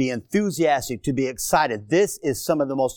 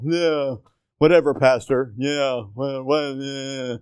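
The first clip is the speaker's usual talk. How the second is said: bored churchgoer; 5 semitones lower, slower than usual. Retold or imitated. Preacher mocks a bored congregant, low mumble. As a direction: imitate a sulky listener.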